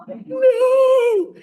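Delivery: high, loud mock cry of alarm; mock panic. Teacher retelling his calculus panic, mock shout. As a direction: yelp it in comic horror.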